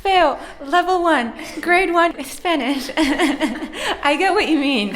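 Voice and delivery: high, loud teasing through laughter; laughing. Real, not acted. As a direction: tease and laugh along, pitched high. Listener teases the speaker, laughing.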